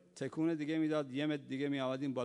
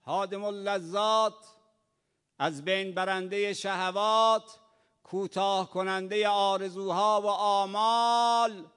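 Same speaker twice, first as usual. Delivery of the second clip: loud, high rising sermon cry; full-force preaching; 6 semitones higher, 9 dB louder than usual. Real, not acted. Preacher crying out to the congregation, loud and high. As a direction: proclaim each title of death louder.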